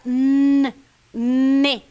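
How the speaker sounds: "n, ne" with the n held long before the vowel; demonstrating the sound. Shown as a demonstration. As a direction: hum the n, then open into ne. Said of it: "Olha o som muito próximo". (som do "n" prolongado em "ne")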